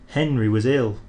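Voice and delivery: ordinary delivery, nothing marked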